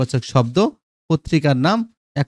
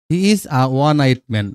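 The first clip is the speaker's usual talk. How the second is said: he is a one eyed man spelled out very slowly; each word of he is a one eyed man said slowly; slow demo; much slower than usual. Shown as a demonstration. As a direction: read it word by word for the class. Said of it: "দেখো ওয়ানের আগে এ বসছে".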